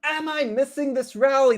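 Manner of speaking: high, worried voice of a nervous investor; mock fretful investor voice; anxious investor. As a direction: ask it like a jittery trader afraid of missing out. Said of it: "lot of people are asking themselves".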